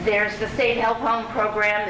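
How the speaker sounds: ordinary talk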